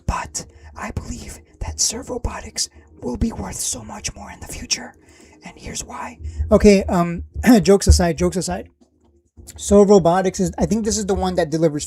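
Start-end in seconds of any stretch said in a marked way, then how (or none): 0.1-6.2 soft, breathy ASMR whisper, jokingly. Pretend. Streamer mock-whispering his intro to viewers, ASMR style. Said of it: "I don't know why I'm talking like this".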